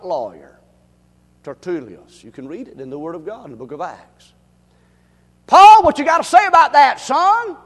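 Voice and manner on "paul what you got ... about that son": high, loud governor's challenge; Felix challenging. Acted. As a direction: call Paul out from the bench. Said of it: "Felix said".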